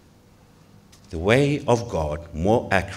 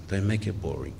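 ordinary talk

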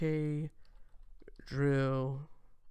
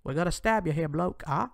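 bad British accent; 3 semitones higher, 4 dB louder than usual. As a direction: put on a hammed-up, clumsy British accent. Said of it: "I can't even do the British accent".

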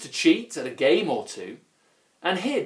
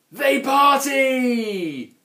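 slow, loud, high party call; gleeful shout; 11 semitones higher, 6 dB louder, much slower than usual. A narrator's reading. Narrator proclaims the trolls' party day, slow and loud. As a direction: belt it out like a party invitation.

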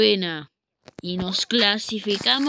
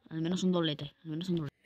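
muttering; 5 semitones lower, 13 dB quieter, much faster than usual. Real, not acted. Muttered to self.

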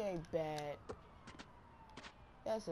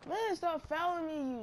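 annoyed yell; 9 semitones higher, 5 dB louder than usual. Real, not acted. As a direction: yell it high and loud at the fouler. Complaining at fouls mid-game.

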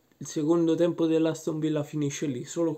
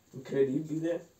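amazed, rising tune; rising exclamation; 3 semitones higher, 4 dB quieter than usual. Acted. Awed reaction, said twice.

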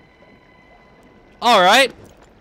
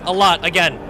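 ordinary delivery

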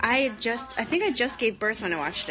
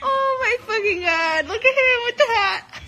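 high loud laughter, almost crying; laughing hard; 13 semitones higher, 7 dB louder than usual. Real, not acted. Reacting to Mike Pence on screen, crying with laughter. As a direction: shriek with laughter.

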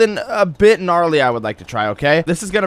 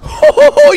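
high whooping laugh; frat bro whoop; 9 dB louder than usual. Real, not acted. Lands a double backflip.

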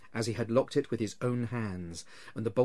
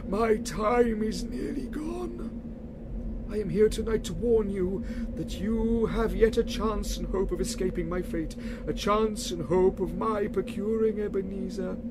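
wailing ghost; 9 semitones higher, 3 dB louder than usual. Acted. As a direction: drag each word out like a wail from the grave.

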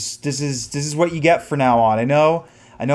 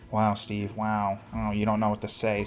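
ordinary talk, nothing marked

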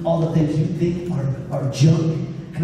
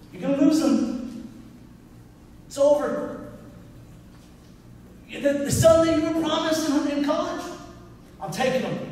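higher, taunting voice of fear; 10 semitones higher, 4 dB quieter than usual. Retold or imitated. Fear taunting the father in the hospital, higher and mocking. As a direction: play fear as a mocking intruder. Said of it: "Fear was knocking on my door".

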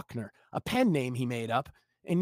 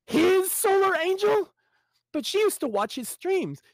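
shocked disbelief. Acted. Jimmy seeing his crush turned on him, high and stunned.

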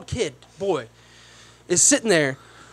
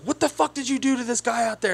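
mock scolding at reduced volume; yelling manager; 5 semitones higher, 6 dB quieter than usual. Manager demanding answers from coworker, mock irate. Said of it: "she starts yelling at one of her coworkers".

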